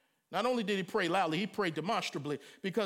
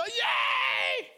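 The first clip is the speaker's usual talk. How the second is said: much higher, louder fan cheer; mock cheer; 13 semitones higher, 4 dB louder than usual. Man cheering at a football game. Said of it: "you go".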